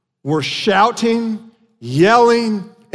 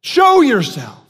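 raised, higher-pitched demand; shouting crowd; 5 semitones higher, 4 dB louder, slower than usual. Retold or imitated. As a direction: call out insistently as the taunting crowd. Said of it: "then they shouted".

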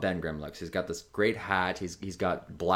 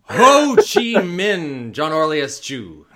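yelling across the room; 7 semitones higher, 10 dB louder, much slower than usual. Retold or imitated. Mock parent calling full name.

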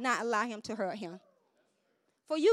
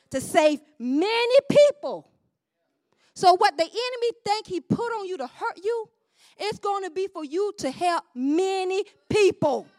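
raised, emphatic sermon voice; full-force preaching; 6 semitones higher, 7 dB louder than usual. Real, not acted. Preacher encouraging the congregation through hardship, emphatic preaching. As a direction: drive the promise home, loudly.

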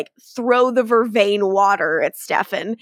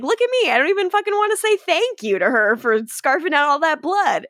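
higher, put-on swagger; mock-tough Enzo; 7 semitones higher, faster than usual. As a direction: brag through it with a hammed-up voice. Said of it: "So Enzo's like".

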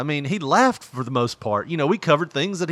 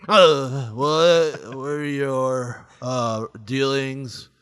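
very slow, halting interviewer impression; Hannibal; much slower than usual. Mocking a horrible interviewer's questions, drowsy slow impression. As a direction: drag each question out painfully slowly. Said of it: "being a horrible interviewer".

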